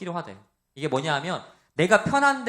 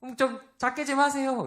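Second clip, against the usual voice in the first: higher, hushed imitation of an elder deaconess; elder lady's request; 8 semitones higher than usual. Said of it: "권사님은 그러셨어요". (권사님이 조용히 부탁하는 흉내, 높은 목소리)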